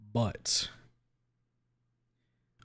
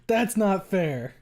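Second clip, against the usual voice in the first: very slow, higher, laugh-tinged complaint; higher mock whine with a laugh; laughing protest; 7 semitones higher, 6 dB louder, much slower than usual. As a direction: drag out the laughing objection.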